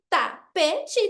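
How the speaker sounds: "tapete" chopped into ta, pe, te; three separate beats on "tapete", pausing between them; syllable by syllable. Shown as a demonstration. Teacher counting pieces for a child, "tapete" split into syllables. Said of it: "tem três pedaços".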